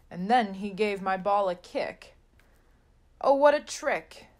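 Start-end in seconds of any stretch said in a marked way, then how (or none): 3.2-4.3 loud, higher exclamation; Oh, what a trick! cried out high and loud; outcry. A narrator's reading. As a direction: burst out at the unfair trick. Ball kicked away, dismay.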